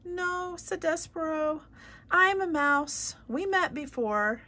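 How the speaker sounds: small mouse voice, high and loud; mouse calling up. Acted. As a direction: insist from down on the floor.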